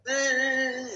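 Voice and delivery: high drawn-out mock wail; imitated crying. Man mimicking the ghost who cries when asked, mock crying. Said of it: "nangis, nangis, nangis".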